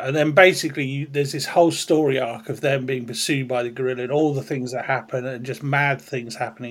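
normal talking voice

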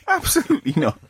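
high, giggling no way; laughing refusal. Real, not acted. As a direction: repeat it through a laugh. Repeats refusal while laughing.

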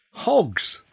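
ordinary delivery, nothing marked

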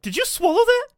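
high, loud mock disbelief; a friend scolds Franco for eating the candy, in high mock disbelief; mock incredulous. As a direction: act shocked that he ate the candy. S2 voices a friend.

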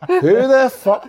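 high, loud imitation of an outraged coach; big Scottish guy. Mimicking the coach hit by the ball, high loud outrage. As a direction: bellow it like a big Scot. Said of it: "Big Scottish guy, like".

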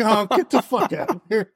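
high mock-outraged cry of disbelief; shocked disbelief. Musician reacting to secretary confession, shocked and high. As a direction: act out his stunned, delighted outburst.

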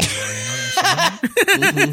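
laughing shout. Real, not acted. Mocking a friend over video game gore, laughing shout. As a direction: crack up while mocking him.

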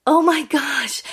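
loud, high retold gasp of amazement; acted-out astonishment; starstruck surprise. Retold shock at spotting celebrity.

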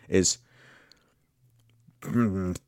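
usual speaking voice

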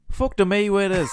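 high, loud mock Irish brawler; Conor McGregor impression. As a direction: ham up a bad Conor McGregor Irish accent. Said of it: "a really poor Conor McGregor".

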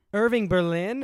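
imitated movie line. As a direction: call it out like a line from an old film. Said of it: "Drew Barrymore in the H B O movie".

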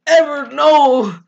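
mock dramatic cry. Bella hearing about Edward, loud mock-anguished cry. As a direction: cry out as if in hammy despair. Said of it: "Bella's like".

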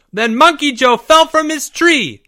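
high, loud group shout; boys shouting. A narrator's reading. Boys defying their teacher, shouting together high and loud. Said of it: "the boys shouted".

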